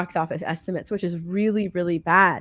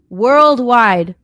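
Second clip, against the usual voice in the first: louder, punched WORLDWIDE; emphatic repeat; 3 semitones higher, 9 dB louder than usual. Real, not acted. Host stressing Worldwide to co-host, loud and emphatic. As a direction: hit Worldwide hard to drive it home.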